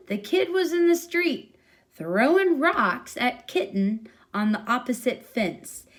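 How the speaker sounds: usual speaking voice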